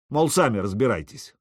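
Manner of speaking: ordinary delivery, nothing marked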